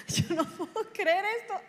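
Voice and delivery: high, amazed retelling through a laugh; laughing disbelief. Retold or imitated. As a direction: laugh as if you can't believe it. Amazed at answered prayer, laughs.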